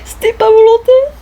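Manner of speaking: squeaky sheepish excuse; high apologetic voice. Acted. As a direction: play innocent in a tiny voice. Excusing herself to monsieur. (petite voix penaude)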